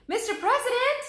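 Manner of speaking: high, loud call across the grove. Acted. Annie calls to the president across the grove: loud high call. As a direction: shout to get his attention. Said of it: "Annie called".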